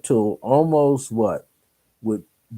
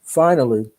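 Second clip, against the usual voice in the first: usual speaking voice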